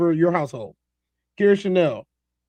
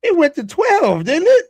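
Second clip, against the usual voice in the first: high, loud astonished exclamation; excited whoa; 9 semitones higher, 6 dB louder, much slower than usual. Real, not acted. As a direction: blurt it out, genuinely amazed. Reacting to a wild clip.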